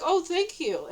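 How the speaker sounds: high, sweetly grateful thank-you; nice thanks. Retold or imitated. Retelling her thanks for the food, nice and happy. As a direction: pitch it up, all friendly. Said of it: "And I was being nice".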